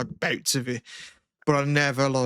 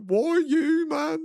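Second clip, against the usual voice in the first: high, drawn-out mock wail; upset; 4 dB louder, much slower than usual. Upset guy on a curb asking why, mock wail. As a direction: imitate an upset guy in a raised voice. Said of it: "he was upset and he was just like".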